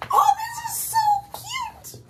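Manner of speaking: very high-pitched squeal of delight; gushing. Real, not acted. Reader gushing over the cute ending, high squeal.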